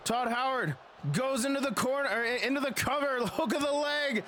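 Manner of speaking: hyped play-by-play wrestling call; wrestling commentator. Acted. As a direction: call the match like live ringside commentary.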